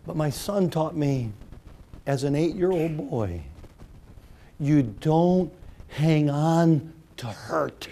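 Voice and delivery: ordinary talk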